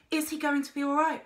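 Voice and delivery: quick, high worried question; fearful rush; anxious. A narrator's reading. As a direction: ask fast, afraid of the answer.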